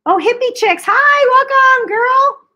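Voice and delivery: high, loud, drawn-out hello; excited greeting. Real, not acted. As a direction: squeal a happy welcome.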